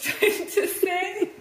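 laughing. Real, not acted. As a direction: giggle breaking in. Laughing mid sentence.

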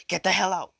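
high, very loud shouted order; sudden full-voiced angry outburst; furious yell. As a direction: yell it out to clear the room. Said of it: "Like, he yells it out".

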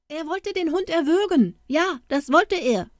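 put-on high, accusing boys' voices; boys in chorus. A narrator's reading. Boys accusing Jakob to the poultry dealer, high and tattling. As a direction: tattle like a gang of kids ganging up. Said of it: "riefen alle Knaben im Chor".

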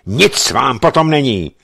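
angry officer refusing to talk; curt anger. A narrator's reading. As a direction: shut him down hard. Bennett cuts him off.

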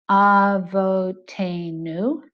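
"avotenu" drawn out very slowly, each syllable split apart, rising at the end; American accent. Shown as a demonstration. Teacher demonstrating how an American says "avotenu". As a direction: chop "avotenu" into separate English-style syllables. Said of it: "An American might normally say".

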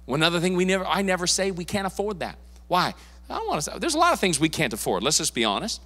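usual speaking voice

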